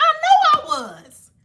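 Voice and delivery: high, smug boast. Real, not acted. Vlogger agreeing she was right about rice water, smug. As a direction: preen a little.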